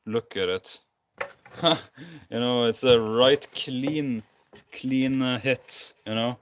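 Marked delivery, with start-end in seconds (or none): 1.2-2.2 bursting laugh, high pitched; quick real laugh at the damage; short laugh. Real, not acted. Laughs at the smashed part. 2.3-6.4 high and louder, slow and amused; amused, laughing. Real, not acted. Laughing at own smash damage.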